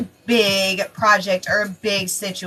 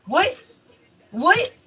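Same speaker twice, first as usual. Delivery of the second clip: sassy rising what, what, what, each one higher; boss bitch mode; 5 semitones higher, slower than usual. Retold or imitated. Tarot reader acting out an Aquarius in boss bitch mode, sassy. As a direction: challenge them like a boss who won't take it. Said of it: "boss bitch mode where I'm like".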